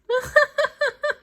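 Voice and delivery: high cackling laugh; mocking laugh. Villain pair laughs at the boy, high cackle.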